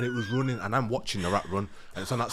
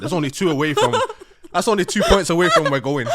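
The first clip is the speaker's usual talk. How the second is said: loud, higher, laughing as you speak; 7 semitones higher, 9 dB louder than usual. Real, not acted. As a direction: talk through rising laughter. Laughing over his near miss.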